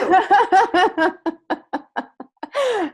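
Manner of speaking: a high burst of real laughter; bright giggling laugh. Real, not acted. Laughing at the session request.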